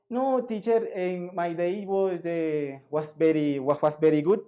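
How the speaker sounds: put-on hesitant student with a heavy Spanish accent. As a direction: stumble through English with Spanish sounds. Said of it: "you're using the Spanish accent to pronounce in English".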